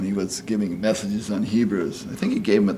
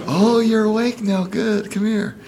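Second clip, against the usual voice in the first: much higher, sing-song coaxing; luring computer voice; 12 semitones higher than usual. Retold or imitated. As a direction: coax the sleeper over like a sly machine.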